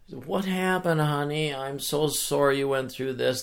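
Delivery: high-pitched cooing concern; acted motherly sympathy, pitched well up; sympathetic mom. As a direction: imitate a mother soothing her hurt child. Mom comforts crying daughter.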